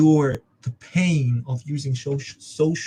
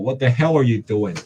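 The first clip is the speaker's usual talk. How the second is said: ordinary delivery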